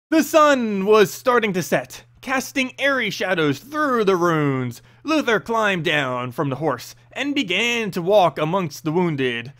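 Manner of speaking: ordinary delivery, nothing marked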